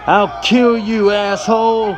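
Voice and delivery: high, loud, slow threatening shout; murderous scream. Acted. Angry cowboy threatening the cheater with a knife, enraged scream. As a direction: scream it like you mean to kill. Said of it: "as he screams out".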